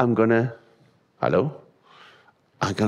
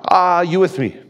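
rallying call; 9 semitones higher, 6 dB louder than usual. Real, not acted. Preacher rallying congregation.

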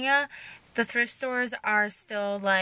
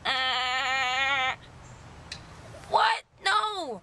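surprised; 2 semitones higher, much slower than usual. Retold or imitated. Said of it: "I'm like".

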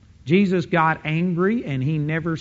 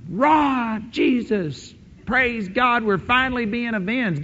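rejoicing saints; 7 semitones higher than usual. Pretend. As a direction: cry out in triumphant joy. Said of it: "the saints are gonna be going".

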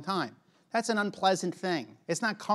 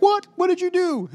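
mock shock; 11 semitones higher, 7 dB louder than usual. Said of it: "you're like".

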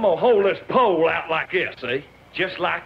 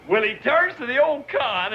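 normal talking voice

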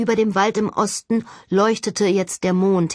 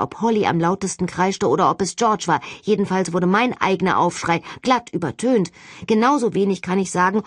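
plain reading voice

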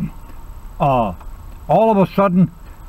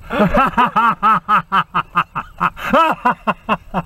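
higher-pitched, rolling chuckles; continued laughter; 4 semitones higher than usual. Real, not acted. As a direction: keep laughing helplessly. Still laughing after punchline.

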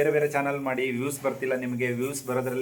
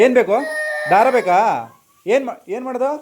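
calling out; 10 semitones higher, 9 dB louder than usual. Real, not acted. Calling to fussing children. (ಮಕ್ಕಳನ್ನು ಜೋರಾಗಿ ಕರೆಯುವುದು)

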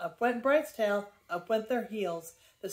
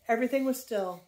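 usual speaking voice

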